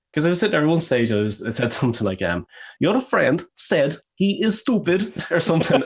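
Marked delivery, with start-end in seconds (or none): 2.8-5.8 high, loud, slow mock accent; Borat. Player voicing his game character, overdone accent. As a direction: overexaggerate every word in a foreign accent. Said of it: "nearly like Borat".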